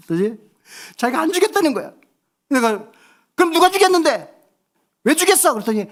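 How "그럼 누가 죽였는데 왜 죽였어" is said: high, loud, teasing accusation; mock grilling. Dad pressing his tearful youngest son, loud mock grilling. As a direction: keep badgering with mock suspicion. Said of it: "막 내가 막 다구쳤어".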